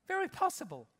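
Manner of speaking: high emphatic repeat; emphatic echo. Acted. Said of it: "repeated for emphasis".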